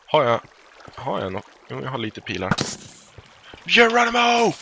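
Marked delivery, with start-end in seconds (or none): 3.6-4.5 much higher, louder Geronimo yell; battle cry. Acted. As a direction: leap and yell it.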